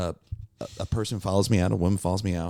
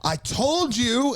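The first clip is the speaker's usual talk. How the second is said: high, slow accusing wail; mock shrill scolding; 4 dB louder, much slower than usual. Heckler scolding the comic, stretched mock shriek. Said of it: "she goes".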